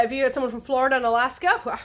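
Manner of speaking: ordinary talk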